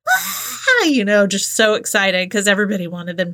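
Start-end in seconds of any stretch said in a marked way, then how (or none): 0.1-0.8 audience scream. Retold or imitated. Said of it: "the live audience screams".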